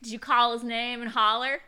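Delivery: loud put-on Southern twang; Southern accent. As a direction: ham up a folksy Southern officer. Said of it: "why we're doing that accent".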